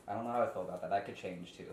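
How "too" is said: usual speaking voice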